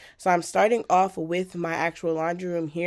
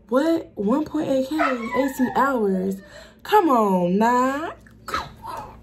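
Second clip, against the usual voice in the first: excited; 7 semitones higher, 6 dB louder, much slower than usual. Real, not acted. Reacting to stats jump.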